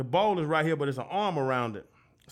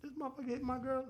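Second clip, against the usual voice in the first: stunned mutter; 9 semitones higher, 11 dB quieter than usual. Retold or imitated. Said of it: "be like".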